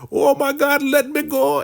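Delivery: very high, hammed-up squeal; comic high-voiced alarm; mock shocked plea. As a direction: play scandalized shock for laughs. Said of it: "she is so shocked".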